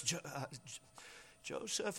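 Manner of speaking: soft, halting stutter on the name; hesitant, broken attempts at a name; stammering. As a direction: stammer quietly, struggling to start. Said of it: "stutters and stammers".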